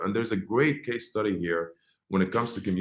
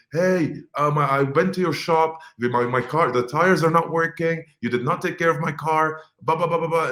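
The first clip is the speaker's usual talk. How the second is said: raised, higher-pitched irate complaint; angry yelling; 6 semitones higher, 4 dB louder than usual. Acted. Customer venting at the shop about his car, angry yelling. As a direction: act out a loud, worked-up customer rant. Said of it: "they're, like, yelling because they're angry".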